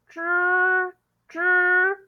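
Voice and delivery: "zh" held long and high, twice; sustained zh sound. Shown as a demonstration. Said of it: "using that mouth shape".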